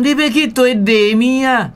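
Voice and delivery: high, sweet and friendly, voice lifted; friendly greeting demo. Shown as a demonstration. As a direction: greet someone warmly, as a friendly shopkeeper. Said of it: "足亲切的吼".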